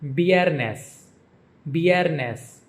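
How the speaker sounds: "viernes" as BYEHR-nes, V like B; Spanish pronunciation. Shown as a demonstration. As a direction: say "viernes" with a B start and first-syllable stress. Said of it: "Friday is pronounced".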